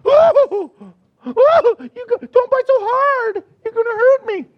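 a hammed-up yelping lion; whiny hurt lion. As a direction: play a big lion crying over cub bites. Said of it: "you never once see the big lion go".

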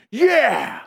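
high imitation meathead yeah; exaggerated lifter shout; mock muscleman bellow. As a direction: holler an eager big-guy yes. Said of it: "you have to talk like that".

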